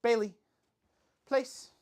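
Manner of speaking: high loud call to the dog; loud, high command to the dog. Real, not acted. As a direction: call her name and command upward.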